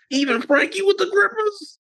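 very high incredulous question; mock horror. Boyfriend asking if Frankie was included, mock horror. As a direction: squeal it in comic disbelief. Retold disbelief at worst name.

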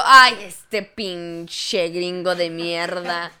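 slow, low, laughing grumble; muttered scorn. Retold or imitated. Waitress mocking a confused gringo, low laughing grumble. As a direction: mutter the insult through laughter.

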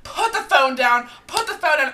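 loud yelled command; mock everyone yelling at her; group yelling. Friend retelling the group shouting at Hannah, loud. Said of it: "everyone's like".

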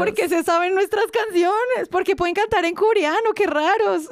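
high, loud, wide-eyed wonder; mock amazed idols. Fan imitates idols amazed by the crowd, high and loud. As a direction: play the stunned humble idol. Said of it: "continues idol quote".

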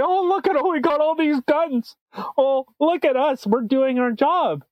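high, smug mocking voice of the police; mocking the RCMP's self-praise; mock bragging. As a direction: sneer it like proud cops showing off.

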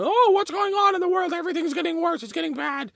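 mock the anxious crowd in a shrill falsetto squeal; mocking worried people. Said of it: "all these people out here going".

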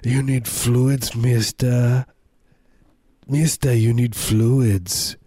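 an old German man insisting in a hammed-up German accent. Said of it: "God, I sound German".

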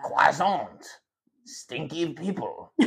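slow, high mock-French caricature; hammed-up French accent, drawn out. Friend showing off a bad French accent. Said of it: "you can't do a French accent".

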